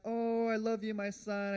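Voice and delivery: high, loud doting mother; imitating mom. Voicing his mom.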